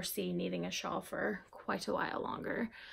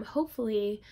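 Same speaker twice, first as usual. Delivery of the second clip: normal talking voice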